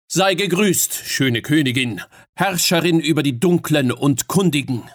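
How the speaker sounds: bow and hail the queen with ceremony; courtly greeting. Acted.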